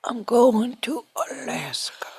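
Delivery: put-on whispered slow old prospector; breathy old-timer whisper, slowly. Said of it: "is that your impression of your dad?".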